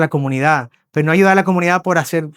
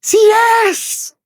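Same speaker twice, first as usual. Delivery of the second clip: mock child's drawn-out yell; child shouting upstairs; 4 dB louder than usual. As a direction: imitate a kid calling up the stairs. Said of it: "el niño grita para arriba".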